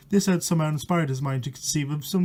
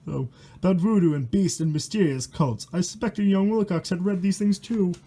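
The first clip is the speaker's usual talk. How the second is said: steady reading of a spooky rhyme; rhyming story reading. A narrator's reading. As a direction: read the verse out like a bedtime horror tale. Reads rhyming horror book aloud.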